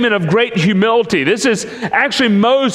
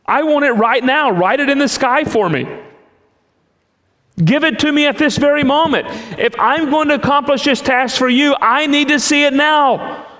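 impatient demand; 7 semitones higher than usual. Retold or imitated. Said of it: "but I'm kinda like".

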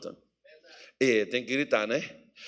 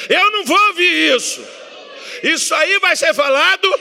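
much higher, loud faith shout; with more faith; 10 semitones higher, 7 dB louder than usual. Shown as a demonstration. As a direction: declare it at full voice. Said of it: "Diga com mais, mais fé".

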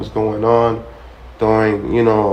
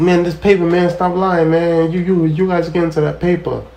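mock pushy friend; 7 semitones higher than usual. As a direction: insist like he thinks you're hiding money. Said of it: "He said".

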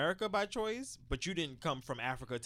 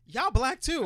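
put-on higher and louder, indignant kid's blurt; exclaimed retort; 11 semitones higher, 7 dB louder, slower than usual. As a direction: call it out, half laughing. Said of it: "I'd be like".

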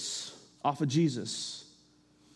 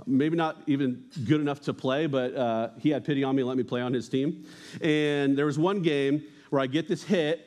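ordinary talk

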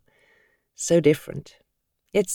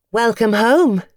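lightly voiced welcoming secretary, higher; 7 semitones higher, 3 dB louder, slower than usual. Secretary Lillian welcoming Tom back, lightly voiced. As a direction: greet the boss warmly.

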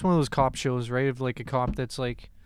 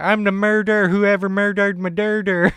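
put-on Philly accent; 6 semitones higher, 9 dB louder than usual. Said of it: "He's like".

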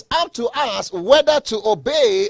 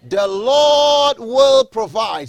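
very slow, higher and louder, each word stretched; drawn-out proclamation; 3 semitones higher, 6 dB louder, much slower than usual. Shown as a demonstration. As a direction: proclaim it for the congregation to echo. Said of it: "Say it with me".

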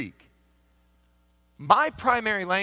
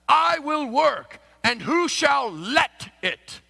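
slow, raised, archaic scripture intonation; King James reading; 11 semitones higher, 9 dB louder, much slower than usual. Shown as a demonstration. Pastor demonstrating the King James wording, slow and raised. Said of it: "King James can be a little misleading".

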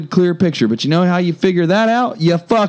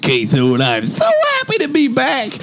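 high put-on hillbilly character voice; Richard Queso voice; 8 semitones higher than usual. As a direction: greet the fans as a hammy old character. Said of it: "I'm Richard Queso".

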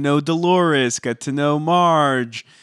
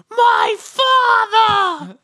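"My father!" wailed very high and drawn out; signature "my father" bit; 5 dB louder, much slower than usual. Acted. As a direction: stretch "My father!" into a soaring wail. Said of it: "I always love when you do the".

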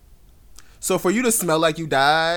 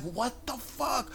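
shocked whisper; 8 semitones higher, 12 dB quieter, much slower than usual. Retold or imitated. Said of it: "I'm like".

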